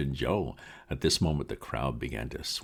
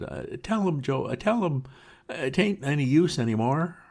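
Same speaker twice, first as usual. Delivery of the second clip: despairing plea; 12 semitones higher, 4 dB louder than usual. Acted. Broken Potter pleads with Joe.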